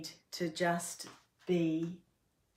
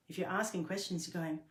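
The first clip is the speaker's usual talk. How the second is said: normal talking voice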